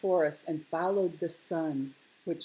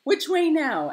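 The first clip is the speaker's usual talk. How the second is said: high, loud little bear; energetic, high-pitched asking; eager question; 12 semitones higher, 10 dB louder than usual. A narrator's reading. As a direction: call out the question keenly. Lars asks eagerly after landing.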